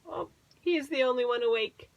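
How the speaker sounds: ordinary delivery, nothing marked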